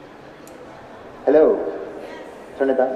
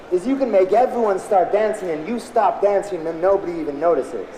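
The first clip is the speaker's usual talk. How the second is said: ordinary talk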